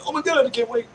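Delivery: gushing excitedly about a friend's new artwork; excited squeal. Retold or imitated. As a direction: squeal with impatient delight. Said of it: "And I'm going".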